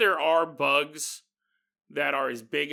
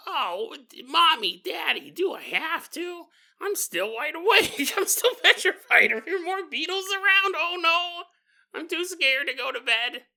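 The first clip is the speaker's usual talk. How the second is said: put-on high, whiny frightened child voice; scared little boy; 11 semitones higher, 3 dB louder than usual. Little Philip pleads with his parents at bedtime, high scared child voice. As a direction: play a petrified four-year-old begging not to sleep.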